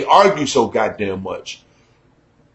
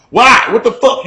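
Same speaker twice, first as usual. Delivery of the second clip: indignant retort; 5 semitones higher, 4 dB louder than usual. Retold or imitated. Acted argument, his retort.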